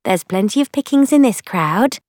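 woman companion's voice. A narrator's reading. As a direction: lift the voice into a friendly female character.

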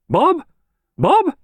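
very high, rising call; lady summoning her dog, pitched right up. A narrator's reading. Mistress calls her dog.

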